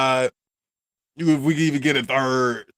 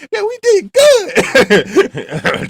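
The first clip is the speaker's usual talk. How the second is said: put-on much higher, slow, laughing kid voice; mock young Michael; 10 dB louder, much slower than usual. Young Michael bragging to his father, high laughing kid voice. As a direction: play the proud little boy, giggling.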